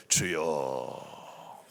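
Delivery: very low, soft, drawn-out pious moan; mock pious prayer. Preacher imitates a man posing in prayer for onlookers, mock pious. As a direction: intone it slowly and deeply, showily devout. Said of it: "폼이 좋은 사람이 있습니다".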